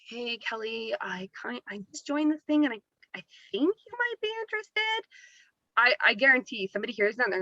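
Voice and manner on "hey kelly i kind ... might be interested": mock timid voice, starting very soft and climbing very high; hesitant, halting demo ending in a squeaky, unsure question; unconfident pitch. Shown as a demonstration. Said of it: "be confident. If you're like".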